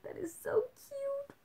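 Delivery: very high, slow cooing; slow, very high squeal at a cute baby; gushing coo. Retold or imitated. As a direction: melt over the baby, stretching it out. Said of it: "I was like".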